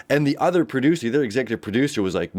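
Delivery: ordinary talk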